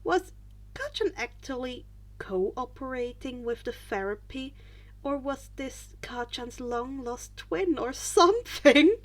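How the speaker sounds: ordinary delivery, nothing marked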